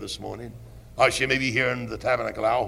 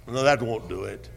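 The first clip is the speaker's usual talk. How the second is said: usual speaking voice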